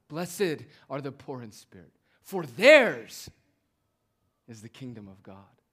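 quoting Jesus with "theirs" thrown out loud. Shown as a demonstration. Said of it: "It's as if Jesus is raising his voice".

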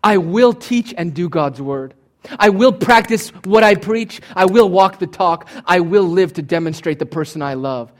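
ringing, earnest yes to God; eager response. Retold or imitated. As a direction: declare it like a wholehearted believer. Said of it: "all of our responses should be".

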